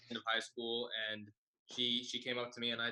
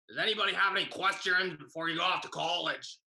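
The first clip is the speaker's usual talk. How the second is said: loud, guttural teacher impression, pitched up; glottal, raised-voice teacher; 6 semitones higher, 4 dB louder than usual. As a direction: imitate her very glottal voice. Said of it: "she sounded like this, very guttural".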